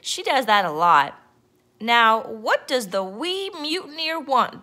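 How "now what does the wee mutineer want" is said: higher-pitched demanding pirate captain. A narrator's reading. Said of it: "Braidbeard demanded".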